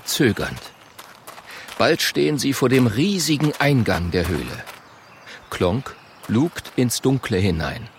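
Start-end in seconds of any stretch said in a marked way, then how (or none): none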